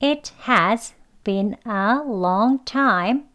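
"It has been a long time" read slowly and clearly; slow first read for learners; slow careful model. Shown as a demonstration. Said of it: "as라는 발음으로 되는 문장은요".

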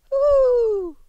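high hooting owl voice, loud; owl asking. Acted. The owl asks the stranger child who he is, hooting. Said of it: "it asked".

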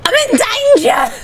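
high, drawn-out comic alarm; mock alarm. Gamer in danger in the game, mock alarm.

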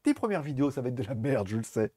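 usual speaking voice

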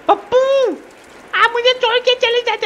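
high squeaky pet-like voice, pitched well up; Pappu voice. Pretend. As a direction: play the little creature whining. Voicing Pappu the game character. (Pappu की ऊँची पतली आवाज़)

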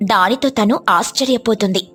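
ordinary delivery, nothing marked

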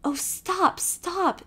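high, pleading cry to stop; imploring. Acted. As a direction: beg him to stop. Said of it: "implored Emily".

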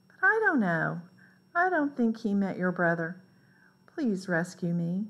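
plain reading voice

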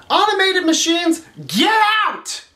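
high, shouted dismissal; mock yell at machines. Caller fed up with automated menus, high mock yell. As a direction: shoo them away.

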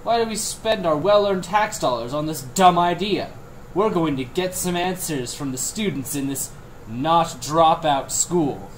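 snide TV reporter voice; hammy investigative reporter; mock news report.

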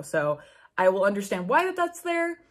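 ordinary talk

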